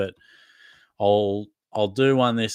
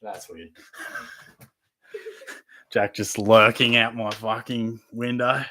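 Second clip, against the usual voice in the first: ordinary delivery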